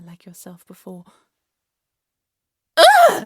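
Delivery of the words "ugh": loud, very high shriek of revulsion; piercing cry of disgust. Acted. Queen is spat upon.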